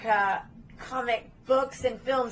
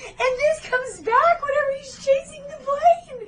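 high, loud, worked-up disbelief; incredulous rant; 6 dB louder than usual. Real, not acted. Podcaster ranting to co-host about a chase, incredulous. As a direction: rant in comic exasperation.

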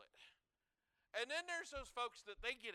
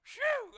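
loud high relieved phew; a loud exaggerated phew of relief; 15 dB louder than usual. Real, not acted. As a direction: blow out big relief. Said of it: "We're all right".

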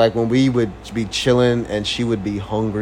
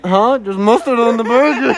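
high, louder, fake shock; mock surprise; 11 semitones higher, 5 dB louder than usual. Boyfriend echoing her mustard complaint in mock surprise. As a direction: echo her line in high disbelief. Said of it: "And I was like".

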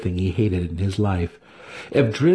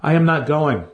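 higher, louder, stress on am; firm declaration; 7 semitones higher, 3 dB louder than usual. Acted. Masoj refusing the raid to Alton: firm declaration. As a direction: plant your feet and declare it. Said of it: "Masoj declared".

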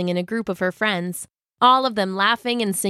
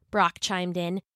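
usual speaking voice

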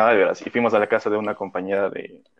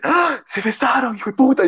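shocked scream; 13 semitones higher than usual. Retold or imitated. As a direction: imitate a friend's high-pitched gasp and outcry. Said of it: "mi amiga grita y dice".

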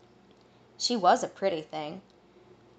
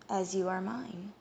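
soft tender reply; 7 dB quieter than usual. A narrator's reading. Tender reply from his mate.

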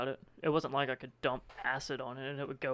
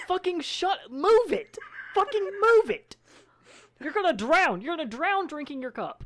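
high, loud panicked yell; frantic yelling; 13 semitones higher, 8 dB louder than usual. Retold or imitated. Shouting at a kid gulping water, panicked yell. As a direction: scream at the kid to move it.

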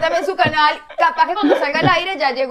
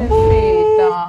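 slow, high, drawn-out pobrecita; a hammed-up pitying coo; mock pity; 9 semitones higher, much slower than usual. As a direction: coo it with exaggerated sympathy. Pitying a guest's ear ringing.

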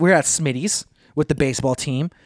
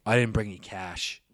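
low, lightly voiced casual guy; a hint of a lower mumbling dude; imitating a guy; 10 semitones lower, 8 dB quieter, slower than usual. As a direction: drop low and shrug it off like a broke buddy. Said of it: "And he's like".

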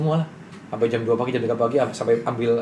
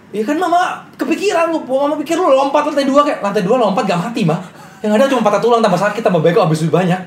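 high, louder imitation of a worried mother; high mom voice; 10 semitones higher, 5 dB louder than usual. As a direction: play the fretting mom, pitched up. Mom's worried rant voiced high. (suara ibu yang cemas, tinggi dan agak keras)

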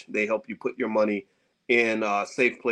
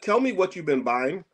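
normal talking voice

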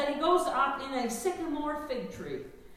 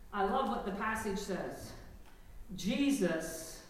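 ordinary talk, nothing marked

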